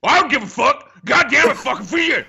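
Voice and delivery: high, loud angry outburst; angry yelling. Retold or imitated. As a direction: yell it in a rage. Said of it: "I'd hear".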